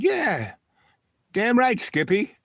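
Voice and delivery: high-pitched, cocky yes; acted-out gleeful agreement; enthusiastic agreement.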